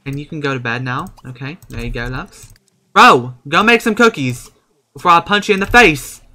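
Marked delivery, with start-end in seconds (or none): 2.9-6.2 mock threat.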